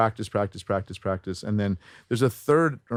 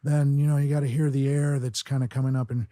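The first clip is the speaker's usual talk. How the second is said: airy voice lifted higher; higher, airier celebrity voice match; Charlie Sheen; 4 semitones higher than usual. Shown as a demonstration. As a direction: let air come up through the words. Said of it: "like if I'm doing Charlie Sheen".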